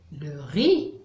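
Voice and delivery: usual speaking voice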